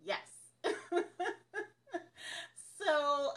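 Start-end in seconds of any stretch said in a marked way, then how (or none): 0.6-1.7 short high giggle; laugh. Real, not acted. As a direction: giggle at the question. Laughing at a question.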